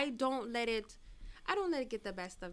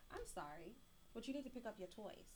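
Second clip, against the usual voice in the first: put-on much softer, gentle apology to a child; 16 dB quieter than usual. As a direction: ease off and say sorry gently. Mother apologizing to her child.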